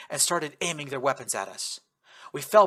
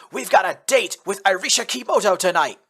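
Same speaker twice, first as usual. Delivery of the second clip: loud, high battle cry; yelling; 6 semitones higher, 8 dB louder than usual. Acted. Cory yelling to rally troops for the night attack. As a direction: fire the men up with a shout. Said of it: "Cory yelled".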